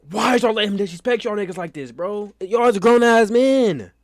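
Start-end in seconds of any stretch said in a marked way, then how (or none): 2.4-3.9 exasperated outburst. Real, not acted. Ranting at the clip.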